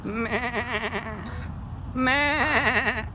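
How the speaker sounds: high, bleating goat meh; goat impression. Shown as a demonstration. Doing a goat impression for the camera, bleating. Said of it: "My goat impression".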